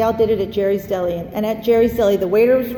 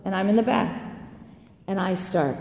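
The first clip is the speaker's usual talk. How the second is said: usual speaking voice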